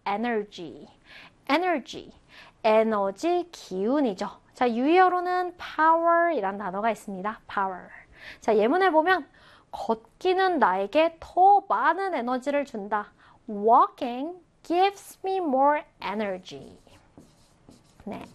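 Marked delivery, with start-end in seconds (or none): 0.1-2.1 "energy" said alone as a careful English model; "energy" given twice for learners to repeat; model pronunciation. Shown as a demonstration. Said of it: "자, 발음해볼까요?". 13.5-16.8 slow, careful teacher's English; drawn-out, evenly spaced words; slow model reading. Shown as a demonstration. Teacher models English example sentence.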